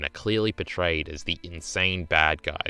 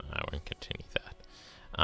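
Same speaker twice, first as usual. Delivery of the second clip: breathy whisper, dropping the rant; whispered aside; 2 semitones lower, 11 dB quieter than usual. Real, not acted. Streamer cutting off his own rant to viewers, whispered. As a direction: hush it as a muttered aside to himself.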